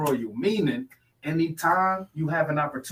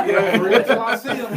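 high, loud repeat through real laughter; laughing; 8 semitones higher, 6 dB louder than usual. Real, not acted. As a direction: crack up while echoing the catchphrase. Amused echo of catchphrase.

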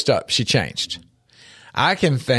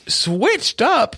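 high, disbelieving question; father baffled by his son's new slang, incredulous; incredulous echo; 12 semitones higher than usual. Retold or imitated. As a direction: repeat it back in disbelief. Dad echoes son's slang.

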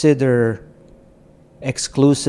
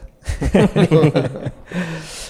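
real laugh; 5 semitones higher than usual. Real, not acted. Laughs at own joke.

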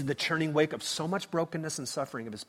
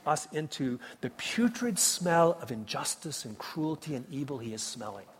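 ordinary delivery

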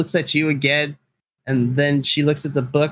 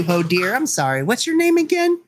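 put-on eager girl; 7 semitones higher than usual. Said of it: "gets ecstatic".

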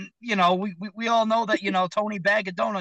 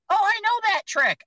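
high-pitched know-it-all heckler; squeaky, much higher mocking voice; 4 dB louder than usual. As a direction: pipe up like an annoying audience member. Acts out a heckler's interruption.

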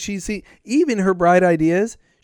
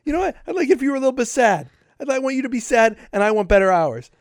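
mock whiny lieutenant; 6 semitones higher, 4 dB louder than usual. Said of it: "lieutenants who are like".

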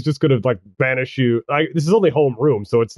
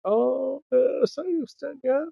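put-on very high, soft, swooning girly whisper; whispering girls; 13 semitones higher, 7 dB quieter, slower than usual. Girls in class whisper about Bert, high and soft. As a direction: swoon quietly over the model walk. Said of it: "They're whispering".

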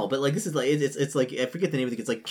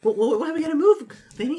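alarmed high voice; 13 semitones higher, 5 dB louder than usual. Acted. NPC alarmed at news.

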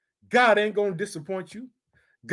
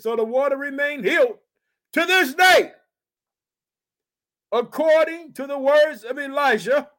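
raised, ringing preacher's reading of scripture; 4 semitones higher, 4 dB louder than usual. Acted. Preacher reads scripture to his congregation, raised and ringing. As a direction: proclaim the verse to the church.

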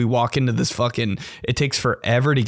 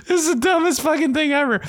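amused disbelief; faster than usual. Real, not acted. Mocking disbelief, laughing.

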